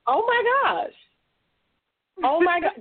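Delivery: high, excited oh my gosh; gasping with delighted shock. Real, not acted. Reacting to juicy book plot.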